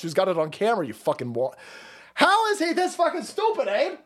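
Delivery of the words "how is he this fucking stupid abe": exasperated disbelief. Real, not acted. Exasperated rant.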